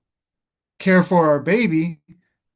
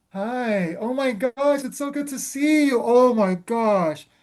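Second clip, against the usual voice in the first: much higher, over-the-top delighted greeting; fake gushing welcome; 6 semitones higher, slower than usual. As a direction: gush at the visitor at the door. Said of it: "we'd be like".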